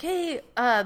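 sweet-talking the bar on the phone; chirpy phone greeting. Retold or imitated. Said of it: "I was like".

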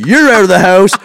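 high-pitched mock tirade; hammed-up angry banishing, high voice; ranting husband. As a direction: imitate a man going bananas at his wife. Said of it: "Goes bananas".

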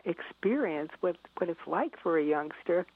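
ordinary delivery

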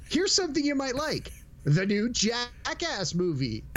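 high sing-out that slows onto the title; Netflix promo voice. Acted. Netflix tempting a viewer away from his show, mock-enticing. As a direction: dangle the tempting recommendation, drawn out at the end. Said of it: "it's like".

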